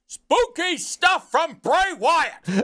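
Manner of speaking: high, slow booming announcer call. Pretend. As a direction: stretch it out like a ring announcer. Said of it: "Do it, [name]".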